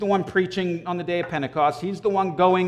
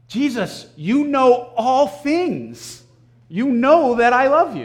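frustrated; 6 semitones higher, slower than usual. Retold or imitated. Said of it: "He's frustrated".

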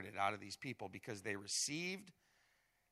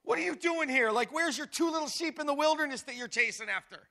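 much higher, louder mocking taunt; demeaning sneer; 11 semitones higher, 6 dB louder than usual. Eliab belittling young David about his sheep, high sneering mockery. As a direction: belittle him like a pesky little kid. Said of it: "Like, he has to say".

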